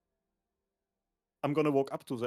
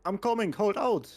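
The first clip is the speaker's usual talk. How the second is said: loud, higher-pitched shout to someone in need; shouting back; 8 semitones higher, 5 dB louder than usual. Acted. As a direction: shout reassurance across a distance. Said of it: "shout back, like".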